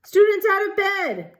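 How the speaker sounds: high, loud bellowing poltergeist cry. A narrator's reading. Peeves bellowing to alert Filch, high and loud. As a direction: bellow it to wake the castle. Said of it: "Peeves bellowed".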